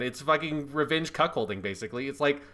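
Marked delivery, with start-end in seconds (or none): none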